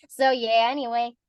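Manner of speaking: normal talking voice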